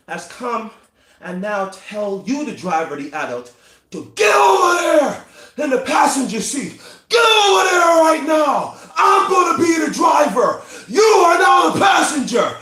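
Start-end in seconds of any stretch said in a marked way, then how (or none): 4.2-12.6 high, full-force yelled orders; furious child seizing the wheel; loud takeover. Acted. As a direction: bellow orders to move over. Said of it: "sorry for the loud noise".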